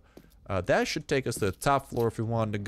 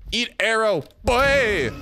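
raised, higher shout building to a yell; loud, high game-combat taunt; taunting battle cry; 8 semitones higher, 6 dB louder, slower than usual. Real, not acted. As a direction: holler it as the shot flies. Attacking an enemy in game.

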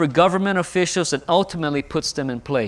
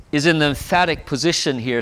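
normal talking voice

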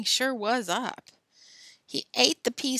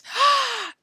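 loud, sharp shocked gasp; 7 dB louder than usual. A narrator's reading. Billy spots the glue, shocked gasp. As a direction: suck in air in sudden discovery.

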